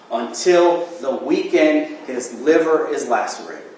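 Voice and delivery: loud, slow and forceful; passionate closing argument. Real, not acted. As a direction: press each point on the jury.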